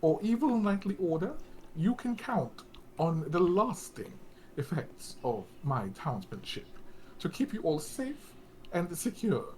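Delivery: high-pitched pompous campaign speech; lofty public proclamation; proclaiming. Acted. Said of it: "you hear him proclaiming".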